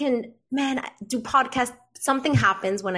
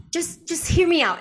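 pleading therapist; 8 semitones higher, 4 dB louder than usual. Retold or imitated. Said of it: "Just softer".

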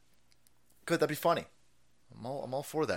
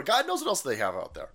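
ordinary talk

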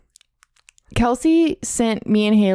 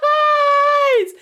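high laughing yell; laughing shout. Acted. Laughing shout of Noise Boys.

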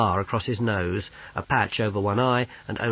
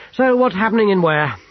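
high, loud, curt question; curt demand; 10 semitones higher, 9 dB louder than usual. A narrator's reading. Officer demanding news of the city, curt and loud. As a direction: demand an answer, sharply. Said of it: "he continued shortly".

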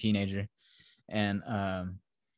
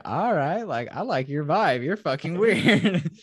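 higher, swooping, amused drawn-out ending; amused approval; 5 semitones higher, 4 dB louder than usual. Retold or imitated. Teen intruder to the woman on her vibe, amused approval. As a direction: imitate a teen digging the weirdness, voice lifting. Playful teen sizing up the room.

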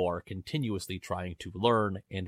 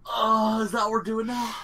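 thrown off; 12 semitones higher, 6 dB louder than usual. Real, not acted. Startled by switch to loud intro.

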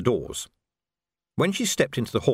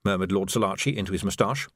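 plain reading voice

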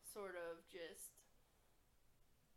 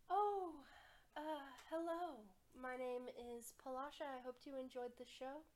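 higher, bright-voiced carnival performer; Palasha voice; 3 semitones higher than usual. Performer Palasha greets the adventurer kindly, in a higher voice. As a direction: greet the party as a sweet performer. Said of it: "looks at you and goes".

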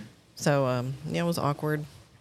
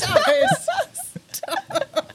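laughing protest; 4 dB louder than usual. Real, not acted. Laughing at teasing.

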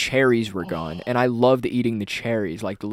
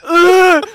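loud, high grunt of rage; angry growling yell; 13 dB louder than usual. Retold or imitated. Red-faced kid in a tantrum, furious yell. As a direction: burst out with a strained roar. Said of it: "I was fucking pissed off".

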